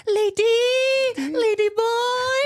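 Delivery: loud, very high mock hostess squeal; heightened ladyboy voice. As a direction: imitate reel hostesses shrieking in falsetto. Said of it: "like, heighten their voice".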